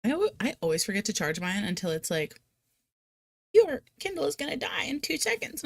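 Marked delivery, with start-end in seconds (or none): 3.5-5.6 higher, urgent imitated alert; mock device warning. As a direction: announce it like a nagging gadget.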